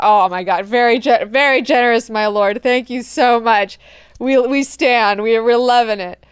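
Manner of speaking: loud, fawning gratitude; mock gushing thanks. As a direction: fawn over your lord loudly. Antiac gushing thanks to Leto.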